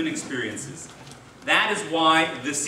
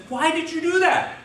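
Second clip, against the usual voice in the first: very high-pitched mock bewilderment; astonished protest; 12 semitones higher than usual. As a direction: squeal the question as a baffled victim. Second punched man protests.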